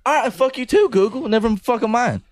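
high, half-laughing mock outrage; mock exasperation. User fed up with the voice assistant, high mock exasperation. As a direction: give up on it in a high, comic huff. Failed voice search, snaps at Google.

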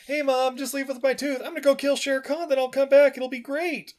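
put-on very high, chirpy boy voice; high-pitched cartoon youngster; eager boy Mowgli. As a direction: play a confident kid reassuring mom. Voices young Mowgli to his mom.

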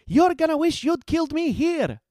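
high, loud yelled threat; defiant yell. A narrator's reading. Heroine taunts guards into chasing her, yelling. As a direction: yell it high, daring them. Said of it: "she yelled".

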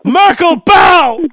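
loud mock royal command; megalomaniac rant. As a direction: bark it like a tyrant king.

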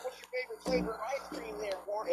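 high, gushing softball question; fawning reporter. Retold or imitated. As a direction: sweet-talk like a fawning reporter. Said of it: "it's like".

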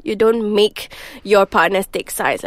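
ordinary delivery

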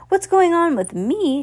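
high, loud furious outburst; angry shout. A narrator's reading. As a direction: explode like a volcano. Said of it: "she shouted".

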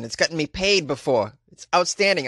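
ordinary talk, nothing marked

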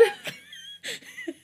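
high-pitched burst of laughter; a shrieking laugh of disbelief. Real, not acted. Laughs at chapter cliffhanger.

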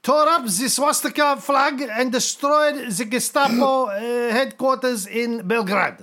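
put-on high-pitched, halting German-accented report; hammed-up German officer; German lieutenant. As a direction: break bad news nervously to your leader.

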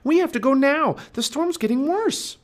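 very high, loud pleading; Annie urgent. A narrator's reading. Girl warning the boy of the storm, urgent and high. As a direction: press him to leave immediately. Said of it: "said Annie".

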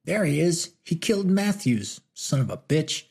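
high shout from the mob. A narrator's reading. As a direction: yell the accusation at Martin. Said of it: "one of them shouted out".

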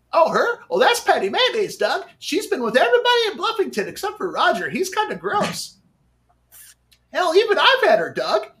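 Skeeter voice. Retold or imitated. Streamer showing off cartoon voices, high cartoon kid. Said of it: "cartoon voices like Skeeter from the cartoon Doug".

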